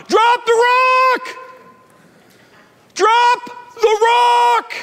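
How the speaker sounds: high, slow shouted call; yelling out. Retold or imitated. Mary yelling to another swimmer, slow high shout. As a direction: shout it across the water, drawn out. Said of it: "she starts yelling out".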